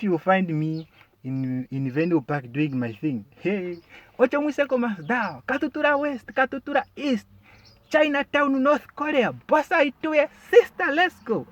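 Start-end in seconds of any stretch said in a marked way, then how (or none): 3.4-11.5 high, loud taxi-rank tout; rapid-fire hawking yell, pitched way up; shouting officer. Shown as a demonstration. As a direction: shout destinations to pull in passengers. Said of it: "upgraded my shouting skills".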